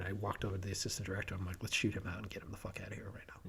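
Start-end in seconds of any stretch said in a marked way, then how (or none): 1.6-3.5 hushed aside whisper. Retold or imitated. Director whispering to assistant director on set. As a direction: whisper it so the extra can't hear.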